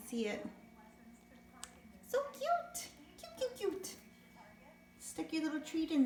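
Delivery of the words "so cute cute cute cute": higher, softer cooing over the pet. Real, not acted. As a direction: coo it tenderly.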